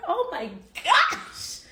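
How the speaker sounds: slow high laughing exclaim; shocked laughing outburst. Real, not acted.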